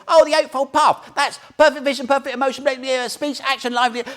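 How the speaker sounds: high, loud, eager schoolchild chorus; eager children. Retold or imitated. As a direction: rattle it off like keen kids showing off. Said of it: "they go".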